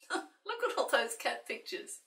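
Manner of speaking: usual speaking voice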